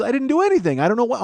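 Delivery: high-pitched, loud defensive protest; flustered denial. Retold or imitated. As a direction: imitate a friend pleading innocence. Friend flustered, denying blame.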